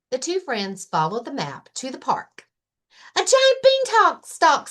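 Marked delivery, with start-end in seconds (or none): 3.1-4.7 very high, loud eager bunny voice; excited Rabbit. Acted. Rabbit offering a picnic to Lion, very high and loud. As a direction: squeal it out happily.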